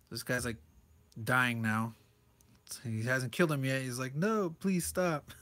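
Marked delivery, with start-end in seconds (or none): 4.1-5.3 slow, higher-pitched mock plea; mock pleading. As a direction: draw out a put-on beg for mercy. Voices victim pleading for mercy.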